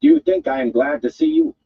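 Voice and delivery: cast-out guild woman rounding on a thug; angry woman. A narrator's reading. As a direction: spit contempt at the big oaf. Woman Aludra voiced.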